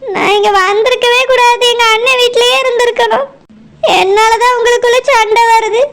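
weep as you blame yourself; second wife crying that she caused the fight. Acted. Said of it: "இப்படி அழுது நாடகம் போடாத".

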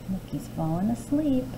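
put-on soft, hushed aside to the child. A narrator's reading. Storyteller whispering to the child about the sleeping giant. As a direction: hush so the giant won't wake.

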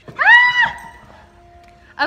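high startled yelp; sudden yelp. Real, not acted. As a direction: cry out at a bite. Puppy biting.

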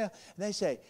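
ordinary delivery

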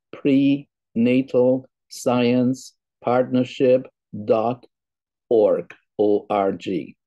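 word by word, very slow, with gaps; slow, separated words for careful note-taking; saying it slowly. Real, not acted. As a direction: spell out the address at dictation speed. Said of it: "I will say it slowly".

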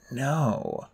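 soft, dazed mutter. A narrator's reading. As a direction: mutter a weak refusal. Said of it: "I muttered".

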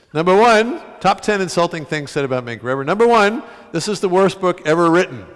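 loud, high announcer-style reading; list announcement. Acted. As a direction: announce the insults like a countdown host. Reading insults list aloud.

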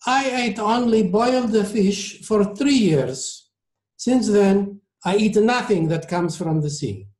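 put-on slow, high-pitched Italian accent; heavy Italian accent. A narrator's reading. Maestro explains his diet at dinner, heavy Italian accent. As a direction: speak deliberately in a thick Italian accent. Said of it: "in his heavy Italian accent".

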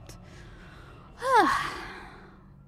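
big, long exhale of relief; relieved sigh. Real, not acted. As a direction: let out a loud sigh of relief. Relief after a tense game moment.

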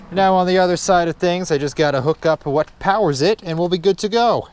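ordinary talk

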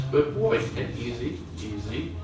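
hushed calming voice to an excited dog; soft calming murmur. Real, not acted. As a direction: ease him down under your breath.

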